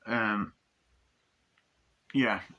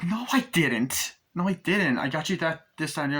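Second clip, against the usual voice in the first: indignant protest; 6 semitones higher than usual. Retold or imitated. Christine protesting to her boss, high insistent denial. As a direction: plead your innocence, pitched up.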